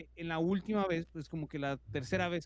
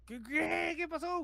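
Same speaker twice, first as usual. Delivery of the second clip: much higher, alarmed mock yell; mother's panicked cry; 10 semitones higher, slower than usual. Startled mother finding her screaming son, mock panicked yell. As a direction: cry out in alarm, without real volume. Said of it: "Gritó".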